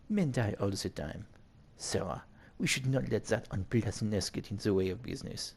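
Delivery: put-on French-accented villain. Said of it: "you want the French accent".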